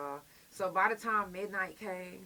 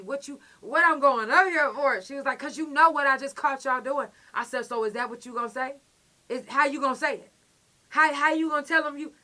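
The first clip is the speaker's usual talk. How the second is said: loud, higher, pressing demands; heated yelling back; 6 semitones higher, 11 dB louder than usual. Retold or imitated. Arguing loudly with the officer who caught them. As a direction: push back at her, louder each line.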